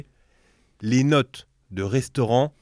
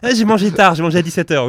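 fake excuse; 5 semitones higher, 8 dB louder than usual. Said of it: "On la connaît tous celle-là".

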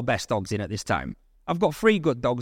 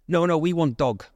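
higher-pitched imitated Russian caller; Russian accent; 4 semitones higher than usual. Caller correcting the dog seller, in a Russian accent. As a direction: put on a Russian accent with broken English. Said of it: "strong Russian accent".

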